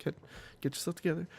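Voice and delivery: very soft, halting near-tears; holding back tears. Acted. Player talking to themselves at a sad moment, softly choking up. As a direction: quietly fight to compose yourself, haltingly. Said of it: "wasn't gonna break down and cry".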